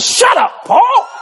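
mama yelling. Retold or imitated. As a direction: play mama snapping at the boy. Mama yelling at son in story.

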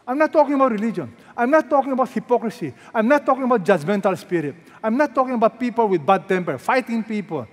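fast, high and loud, hammering each I'm not; preaching at full force. Acted. Preacher insisting to the congregation, fast loud preaching. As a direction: rattle them off like a rising sermon. Preacher fires off repeated denials.

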